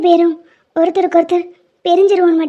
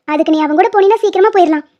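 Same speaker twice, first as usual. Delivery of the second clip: usual speaking voice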